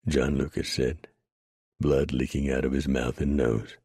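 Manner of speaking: ordinary delivery, nothing marked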